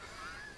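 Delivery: high wheezing gasp for air; asthmatic wheeze. Retold or imitated. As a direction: gasp like an asthmatic mid-act. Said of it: "I'll be like".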